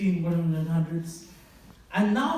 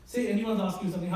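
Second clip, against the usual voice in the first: normal talking voice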